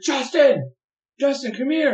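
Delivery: high, loud call across the house. Retold or imitated. Wife calling Justin from the bedroom, loud summons. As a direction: yell his name to summon him. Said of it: "and she's like".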